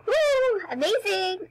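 loud high woo of delight; cheering. Real, not acted. Girl cheering the dancing team, loud and high. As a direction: cheer them on.

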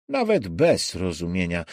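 ordinary delivery

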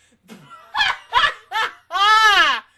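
loud, high cackle; explosive high laugh ending in a long hoot; burst of laughter. Real, not acted. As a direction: burst out laughing mid-thought. Bursts out laughing at own joke.

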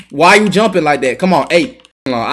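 ordinary delivery

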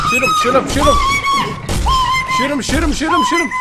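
shrill panicked shouting; frantic shout. Real, not acted. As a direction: scream the order desperately. Frantic shouting during attack.

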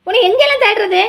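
high, loud exasperated scold. Acted. Woman scolding Mayilu after searching for her, loud and high. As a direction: tell her off for hiding.

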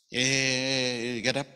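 long drawn-out "Hey", then get up; interrupting call. Retold or imitated. God stopping Moses from praying, drawn-out mock call. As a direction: stretch the Hey out on a level note. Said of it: "He didn't say it like that".

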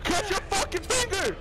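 loud, high frantic yelling; panicked shouting. Real, not acted. Caught in a trap while the dog comes, frantic shouting. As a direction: yell in a rising panic.

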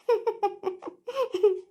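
long, rippling chuckle; amused laughter. Acted. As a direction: laugh on at the joke. Laughing at the bus joke. (ತಮಾಷೆಗೆ ಜೋರಾಗದ ದೀರ್ಘ ನಗು)

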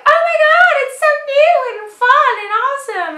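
very high, loud, squealing hype voice; gushing fangirl impression. Mocking the hype around Tony Moly, squealing high fan voice. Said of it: "And everyone's like".

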